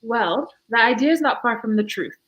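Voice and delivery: normal talking voice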